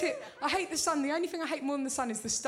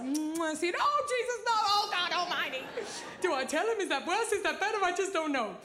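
high, flustered hotel guest; mock panic; 7 semitones higher than usual. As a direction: play someone panicking, pitch jumping up. Acted hotel guest panicking at the message.

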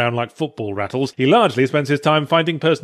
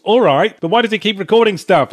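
higher, louder concession turning into a challenge; exasperated objection; 5 semitones higher, 4 dB louder than usual. Acted. Critic arguing back about the cameraman's recordings, exasperated objection. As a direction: concede impatiently, then push the question. Acted objection in a mock argument.

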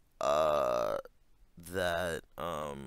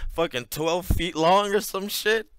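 louder and higher, laughing at the absurdity; exaggerated outburst; 8 semitones higher, 8 dB louder than usual. Real, not acted. Mocking an absurd claim, louder and higher. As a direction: blow it up bigger.

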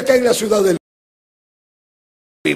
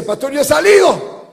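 calling out; 6 semitones higher, 4 dB louder than usual. Retold or imitated. Dead woman calls to the pastor.

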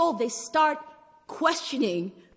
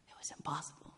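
hushed whisper; 9 semitones lower, 19 dB quieter, faster than usual. Real, not acted. Hushed emphasis in sermon.

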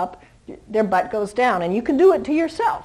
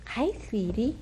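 much softer hi sweetie; soft greeting; 10 dB quieter than usual. Real, not acted. Trainer greeting the little puppy: soft gentle coo.

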